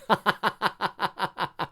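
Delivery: bursts out laughing; a real embarrassed laugh. Real, not acted. Laughs at his own slip.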